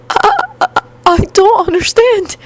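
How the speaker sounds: very high, stammering and frightened; faint frightened response. A narrator's reading. Shocked new hero student answering his teacher, faint and frightened. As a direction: squeak it out, barely getting the words started. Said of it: "he responded in barely a whisper".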